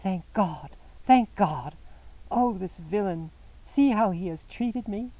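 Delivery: overwhelmed relief turning to rage; distraught woman. A narrator's reading.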